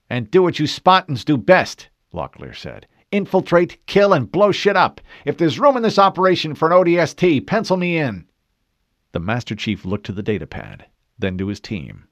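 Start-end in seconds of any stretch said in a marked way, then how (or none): 0.1-1.9 high, loud, fired-up interjection; eager marine. A narrator's reading. Marine eagerly jumps into the plan. 3.1-8.3 high, loud, fired-up volunteering; eager marine. A narrator's reading.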